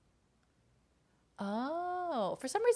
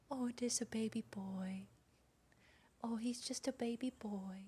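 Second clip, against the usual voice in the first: hushed cooing; 8 dB quieter than usual. Real, not acted. To the sleeping baby cat, hushed cooing. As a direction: coo quietly so he stays sleepy.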